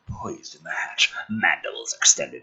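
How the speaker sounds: breathy tense whisper. Acted. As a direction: hush it like a horror trailer.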